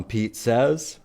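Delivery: usual speaking voice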